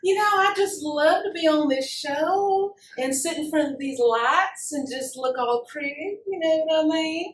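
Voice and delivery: drawling Southern belle character; Southern accent. Acted. Showing off a character to the interviewer, Southern accent. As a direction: put on a playful Southern accent. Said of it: "with this Southern accent".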